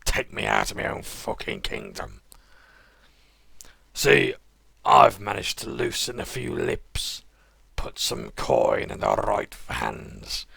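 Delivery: loud, slow, menacing crime boss; smug sewer kingpin with a Dudley accent. Acted. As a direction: lean in like a gangster offering a deal. Sewer King monologue continues.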